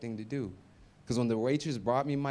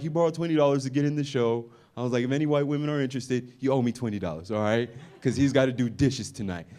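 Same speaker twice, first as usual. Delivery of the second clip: mock roast; 4 dB louder than usual. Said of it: "I'm like".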